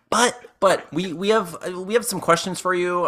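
high, stumbling polite request; hesitant interjection. Acted. Customer turns to shopkeepers with questions, high hesitant interjection. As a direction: stammer in high, then get it out. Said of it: "I'm like".

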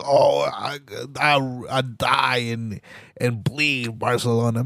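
lightly hammed-up swooning devotion, dragged out; mock diehard fan. Imitating Barcelona fans to ridicule them, mock diehard devotion.

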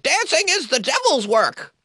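high-pitched hammed-up old dude denouncing sin; mock old prude. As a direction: squeal it like a scandalized old moralist. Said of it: "Parliament is a lot of old dudes".

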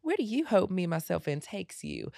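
very light, high country voice; airy, high-pitched Southern lady accent. Shown as a demonstration. Host reading the question as a country singer, very light country accent. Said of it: "very light".

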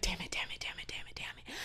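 voiceless fading whisper; panicked whisper. Retold or imitated. Woman seeing a man approach her car, whispered panic. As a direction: whisper curses under your breath, fading out. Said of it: "I'm like".

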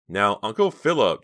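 soothing, coaxing address; calm her uncle down gently; placating. A narrator's reading. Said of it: "Justine said placatingly".

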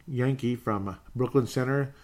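ordinary delivery

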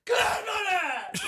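very high, drawn-out strained cry; shouted name tag. Acted. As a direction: sign off like a rapper shouting his own name. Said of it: "shouting your name at the end".

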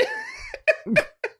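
very high squealing laugh. Real, not acted. Reviewer cracking up at a sex lyric, squealing laugh.

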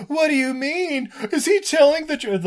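high-pitched, whiny disbelief; mock dismay. Hero hearing he won't get his girlfriend back, mock dismayed whine. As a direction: plead it in comic bewilderment.

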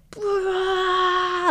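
short high mock primal yell; primal scream. Recalling her scream in the hospital ward, a mock primal yell. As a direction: let out a hammed-up primal shout. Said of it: "full on primal scream".

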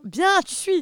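high, loud patronising praise; playful teacherly pat on the head; mock teacher. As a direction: praise her like a schoolteacher.

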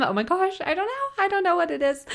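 high-pitched gushing squeal; giddy high-pitched rush of joy; excited squeal. Real, not acted. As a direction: squeal it out, too happy to say it. Gushing over nickname for baby.

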